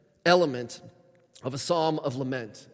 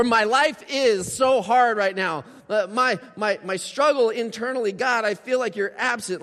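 pleading lament; 6 semitones higher, 5 dB louder than usual. Pretend. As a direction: pour out a hard life to God. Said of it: "they'll say something like that".

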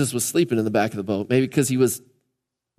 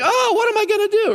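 panicked cry. Retold or imitated. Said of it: "was like".

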